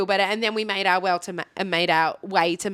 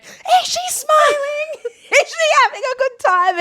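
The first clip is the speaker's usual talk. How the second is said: much higher, slow, louder squeals; excited squeal; 6 dB louder, slower than usual. Retold or imitated. Asking friend about Lani on her shoulders, squealing high. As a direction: squeal it with delight. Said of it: "And I say to my friend".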